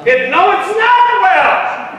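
high, loud, slow anguished wail; anguished cry. Retold or imitated. Preacher voicing the grieving mother's cry, high and loud. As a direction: cry out in grief.

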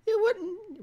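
high-pitched cartoon old lady; Mickey Mouse voice. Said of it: "why do I sound like Mickey Mouse".

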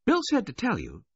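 high-pitched voice of a woman on the phone; Missus Baudelaire. A narrator's reading. Missus Baudelaire passing on Bill's message, in a high voice.

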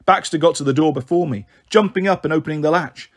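plain reading voice

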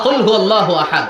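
brisk verse quotation without melody; spoken declamation. Shown as a demonstration. Preacher quoting the verse to explain it, spoken declamation. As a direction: declare the verse in speech, not chant.

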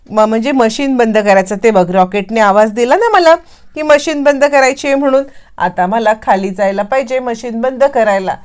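plain reading voice

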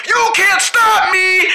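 defiant shout. Acted. Escaping captors.

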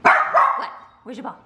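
ordinary talk, nothing marked

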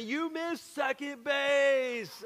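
high slow mocking yell; retold scream. Everybody screaming at the new kid about the missed base, mild mock yell. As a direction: teammates calling out his mistake. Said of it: "everybody's screaming at me".

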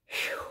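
breathy sigh of relief; deep sigh. A narrator's reading. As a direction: blow out a long "phew". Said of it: "letting out a deep sigh".

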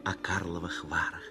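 normal talking voice

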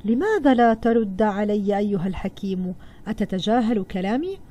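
ordinary delivery

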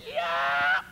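very high mock kia cry; karate yell. Karate man retelling his first speed break, high yell. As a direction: yelp it like a board-breaking strike. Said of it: "then I'm gonna yell".

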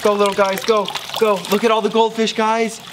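high, eager cheering on; excited cheering. Real, not acted. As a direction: urge them on with glee. Cheering goldfish into pond.

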